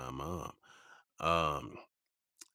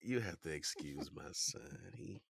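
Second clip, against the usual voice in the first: put-on soft, confiding mother excusing her child; apologetic mom; 3 semitones higher, 8 dB quieter than usual. Mom to a caller about her odd son, hushed apology. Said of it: "She's like".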